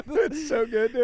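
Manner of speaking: high, loud, through laughter; laughing. Real, not acted. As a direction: gush it while cracking up. Cracking up over the impression.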